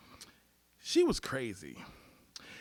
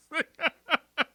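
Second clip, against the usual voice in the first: high gloating cop laugh; hammed-up high cackle at a suspect; acted laughing; 9 semitones higher than usual. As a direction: burst into mocking laughter. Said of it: "He starts laughing".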